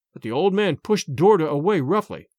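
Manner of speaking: ordinary delivery, nothing marked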